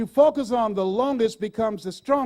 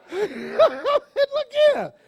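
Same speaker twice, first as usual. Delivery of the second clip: laughing; 14 semitones higher, 6 dB louder, much slower than usual. Real, not acted.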